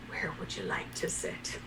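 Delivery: low, soft, near-whisper; hushed reverence. Acted. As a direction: ask softly, humbled before a magical creature. Awed Scarlet kneels, tearful, to unicorn.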